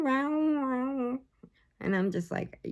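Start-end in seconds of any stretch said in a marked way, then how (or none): 0.0-1.2 cat meows. Retold or imitated. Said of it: "it's meow".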